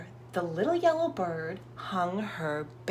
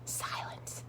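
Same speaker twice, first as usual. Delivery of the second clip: ordinary delivery, nothing marked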